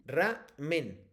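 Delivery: "Ramen" broken into ra, pause, men; split syllables. Shown as a demonstration. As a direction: sound out "Ramen" slowly in two beats. Teacher sounds out word for pupil.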